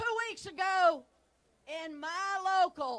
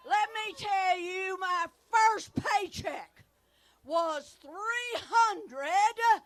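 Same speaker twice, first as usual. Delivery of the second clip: ordinary delivery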